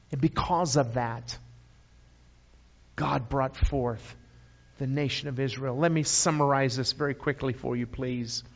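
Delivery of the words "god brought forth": normal talking voice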